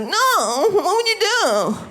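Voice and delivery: put-on high, slow insistent girlfriend voice; hammed-up pleading girlfriend; mock girlfriend whine. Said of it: "she's like".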